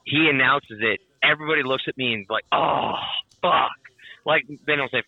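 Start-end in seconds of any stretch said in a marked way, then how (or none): 2.5-3.7 drawn-out groan of disappointment; mock devastated. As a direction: imitate a crowd's crushed letdown. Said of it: "fucking devastated".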